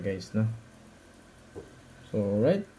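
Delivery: ordinary delivery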